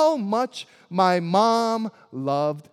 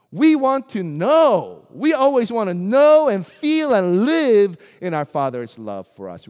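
urgent preaching; 9 dB louder than usual. Acted. Preacher stresses longing of children.

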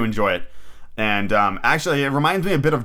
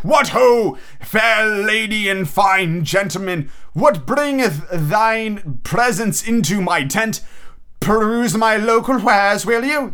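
high, slow, hammed-up olde-English merchant; mock Ren Fair vendor; 9 semitones higher, slower than usual. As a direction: play a theatrical fair peddler inviting customers in. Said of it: "when they talk like that".

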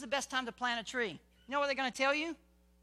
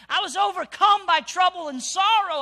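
sorrow rising high and loud; loud anguished reading; 7 semitones higher, 13 dB louder than usual. Real, not acted. Preacher reading the psalm aloud to the church, loud and anguished. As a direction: cry out the trouble. Scripture read with force.

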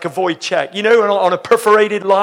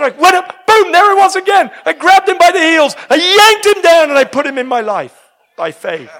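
high, loud, breakneck-excitement reenactment; excited retelling; 10 semitones higher, 6 dB louder than usual. Retold or imitated. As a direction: act out her triumphant grab at full voice. Acting out girl grabbing her husband.

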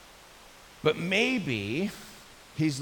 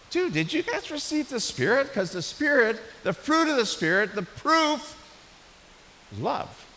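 urgent challenge; 8 semitones higher, 4 dB louder than usual. Acted. Acts out Paul challenging the believers.

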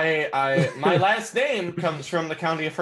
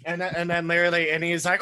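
usual speaking voice

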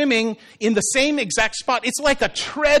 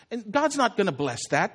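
ordinary talk

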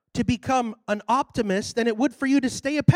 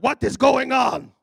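baffled, imitated African preacher; African pastor; 3 semitones higher, 5 dB louder than usual. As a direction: demand answers in an African accent. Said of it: "Big old African guy".

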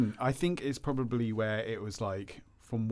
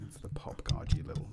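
whispered teasing correction; hushed whisper; 7 semitones lower, 5 dB quieter than usual. Real, not acted. Whispering a correction to the cohost, hushed. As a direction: drop to a breathy whisper to tease.

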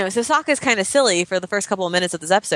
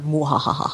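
sneering panto villain; 4 semitones lower, 3 dB quieter than usual. Retold or imitated. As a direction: cackle like a stage villain. Evil laugh after villain quote.

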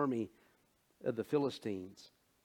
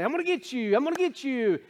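high, teasing chase voice; singsong playful threat, pitched way up; playful parent; 12 semitones higher, 6 dB louder, faster than usual. Acted. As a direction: tease a kid you are about to chase. Parent playfully chasing a child.

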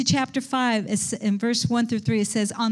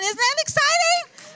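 pitched far higher, pushing harder on exciting; even more excited; 14 semitones higher than usual. Real, not acted. Preacher repeating the question to the church, even higher and more excited. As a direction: go up again and sell it harder. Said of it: "Oh, absolutely".

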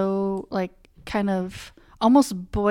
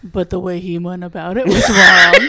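laughing; 10 dB louder than usual. Real, not acted. Laughing while making the point.

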